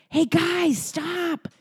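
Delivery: put-on high child voice, dropping to an earnest whisper; little boy reminding everyone. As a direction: play a tiny preacher calling everyone to stop. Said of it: "he says".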